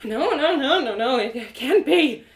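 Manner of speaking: acted dismay; horrified disbelief. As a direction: refuse to believe it, mildly panicked. Horror at seeing butchered goat.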